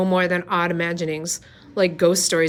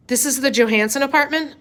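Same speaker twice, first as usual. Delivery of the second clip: a loud, higher, barking question from a soldier; a soldier demanding at the door, louder; 8 semitones higher, 5 dB louder than usual. A narrator's reading. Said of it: "a deep voice asked question loudly".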